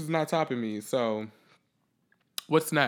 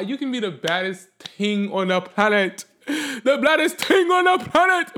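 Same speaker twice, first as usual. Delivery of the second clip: put-on Jamaican accent, building to a loud high shout; exaggerated Jamaican accent, rising in pitch and volume; 8 semitones higher, 7 dB louder than usual. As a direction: belt it out in a mock Jamaican accent. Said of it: "My Jamaican accent".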